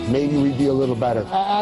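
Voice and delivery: ordinary talk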